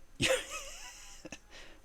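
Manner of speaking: high squeaky laugh; high squeal laugh. Real, not acted. Streamer laughing at his own joke, squeal. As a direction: let out a high giggle.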